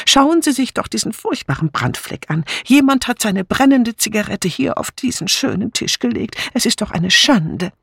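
high-pitched, fussy disapproval; disapproving. A narrator's reading. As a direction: complain in a high voice. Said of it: "bemerkte Dora Banner missbilligend". (missbilligend, hohe Stimme)

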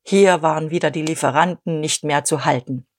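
usual speaking voice

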